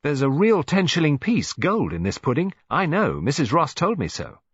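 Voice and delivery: put-on high, loud insistent child; eager boy. A narrator's reading. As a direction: jongen die aandringt. Boy insisting about coin.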